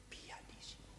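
barely voiced whisper; whispered demonstration of pianissimo; very soft. Shown as a demonstration. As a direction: show the choir how soft it must be.

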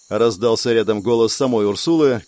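usual speaking voice

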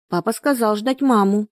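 put-on high child's voice answering; narrator's high-pitched little-girl voice. A narrator's reading. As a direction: play a small girl answering. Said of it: "ответила ему девочка".

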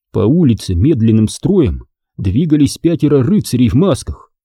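ordinary delivery

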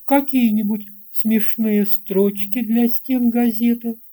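ordinary delivery

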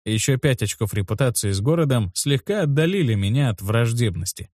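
plain reading voice

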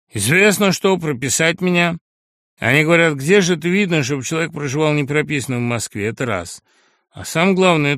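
a brash lout talking back; crude upstart voice. Acted. Sharikov argues his rights.

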